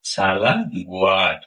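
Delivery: teacher modelling the Malay phrase slowly and softly; slow soft demo. Shown as a demonstration. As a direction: ease the phrase out slowly and quietly. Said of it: "তাহলে কি বলবেন?".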